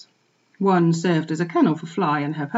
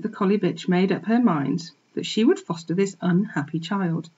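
ordinary delivery

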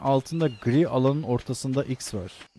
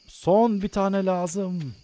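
"Son" drawn out slow and a bit higher; slow dramatic stretch; 5 semitones higher, much slower than usual. Shown as a demonstration. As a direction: stretch "Son bir tane lazım" slowly, leaning on "Son". ("Son" kelimesini uzatarak, yavaş ve dramatik)

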